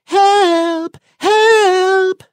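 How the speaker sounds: very high singing cry for help; harp cries for help. Acted. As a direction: wail like a magic harp calling out. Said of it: "the harp begins to sing".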